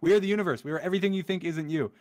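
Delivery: ordinary talk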